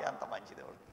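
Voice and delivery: normal talking voice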